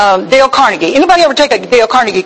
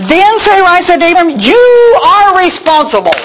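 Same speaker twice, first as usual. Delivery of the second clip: nagging wife; 7 semitones higher than usual. Acted. Preacher voices blaming wife.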